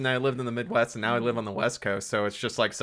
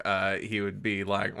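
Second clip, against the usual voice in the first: ordinary talk, nothing marked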